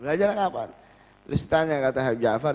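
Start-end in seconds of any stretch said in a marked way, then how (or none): 0.0-0.7 high, fast astonished question; the scholars astonished he can suddenly read; surprised people. Retold or imitated. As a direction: ask it in amazement. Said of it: "Kaget orang".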